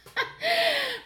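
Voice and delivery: high burst of laughter; sudden high-pitched laugh. Real, not acted. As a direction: crack up at your own remark. Laughs at her own remark.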